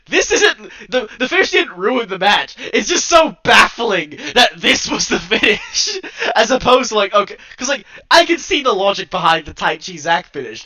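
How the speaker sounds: loud, high-pitched incredulous rant, laughing; exasperated rant. Real, not acted. Incredulous rant about baffling finish.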